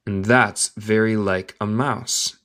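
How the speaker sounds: slow whole sentence, no long gaps; slow connected reading. Shown as a demonstration. Teacher repeating the line for learners, slow and connected. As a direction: link the words at a learner's pace.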